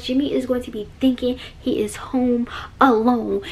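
hushed voice, no tone; whispering. Real, not acted. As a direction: whisper so he won't hear. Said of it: "why I'm whispering".

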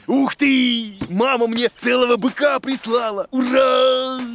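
high, loud laughing cheer, half sung; joyful whoop. Acted. Boda cheers loudly about mum's bull. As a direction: cheer with delight.

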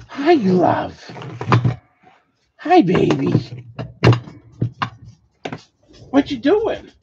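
high sing-song pet voice. Real, not acted. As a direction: coo at the dog. Talking to pet in baby voice.

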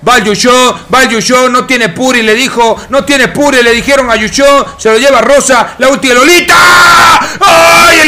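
real excited commentary; excited play-by-play. Real, not acted. Live call of a fight's climax.

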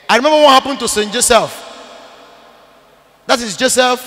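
ordinary talk, nothing marked

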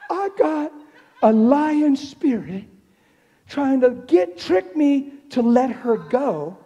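very loud, high-pitched preacher's shout; impassioned preaching. Real, not acted. Preacher exposes the spirit that tried tricking him, shouting. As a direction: cry it out at full force, stretching key words.

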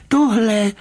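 high, curt pointing-out. A narrator's reading. Rybka showing the commissioner the last footprint, curt and high. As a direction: say it high and emphatic, no elaboration. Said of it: "řekl krátce pan Rybka".

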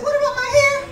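high, laughing mock voice for an audience member; mocking quote. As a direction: parrot her in a high squeal through laughter. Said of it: "Oh, she said".

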